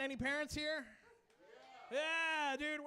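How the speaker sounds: usual speaking voice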